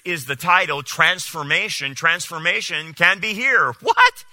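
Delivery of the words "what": a very high, loud, stunned "What?"; outraged by the pastor's words; shocked what. Real, not acted. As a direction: yelp out your disbelief. Reacting to the quoted claim.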